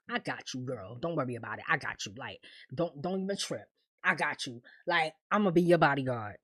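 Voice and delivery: low, soft, reassuring male role; voicing Victor. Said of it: "And Victor is like".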